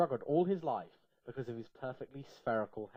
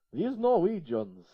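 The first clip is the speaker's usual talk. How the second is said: loud, slow, odd character voice; a slow, loud, put-on storybook character; Charles's voice; 6 semitones higher, 9 dB louder, slower than usual. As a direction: drag it out in a silly put-on voice. Charles speaks in story, far voice.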